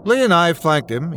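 ordinary talk, nothing marked

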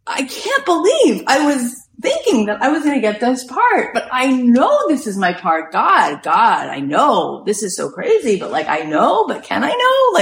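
acted-out inner argument, pitch swinging high; mock-anguished wavering; agitated self-doubt. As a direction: argue with yourself, half pleading. Retells her torn inner argument.

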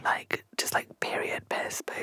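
very soft, low whisper; whispered overshare; hushed confession. Real, not acted. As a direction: murmur it almost without voice. Confiding an embarrassing aside.

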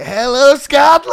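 shouted high and loud like a rock star greeting the crowd; mock stage shout. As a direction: belt it out to the whole arena. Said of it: "just going like".

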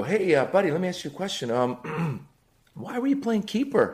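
trying to sound supportive; fake calm dad. Dad in car asking his son, falsely casual. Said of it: "and I go".

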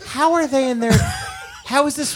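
high, laughing disbelief. Real, not acted. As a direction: squeal it in disbelief.

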